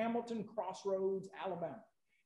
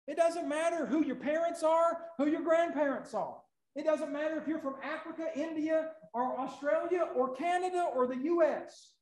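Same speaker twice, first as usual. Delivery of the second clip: loud, high, building sermon cry; rising revival preaching, louder and higher; preaching at full force; 6 semitones higher, 7 dB louder than usual. Acted. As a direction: preach it out to the whole church.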